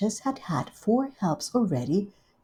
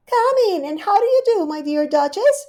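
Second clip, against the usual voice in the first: much higher, louder gushing hostess; Rivy's voice; 13 semitones higher, 8 dB louder than usual. Acted. Rivy welcoming Duchess, high gushing hostess. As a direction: greet her sweetly and grandly.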